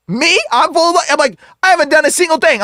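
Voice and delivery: high-pitched mock offence; indignant denial. Man accused of lust by a demon, indignant. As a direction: protest as if wrongly accused.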